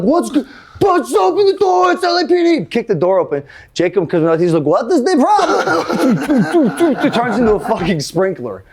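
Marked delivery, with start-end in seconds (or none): 0.0-2.6 high, yelled mock cop command; pitched-up imitation of a police raid; police shout. Acted police at the door. 4.6-7.0 high, drawn-out mock Swedish accent; slow, oblivious Swedish kid, pitched way up; hammed-up Swedish innocence. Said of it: "that's the best Swedish accent I got".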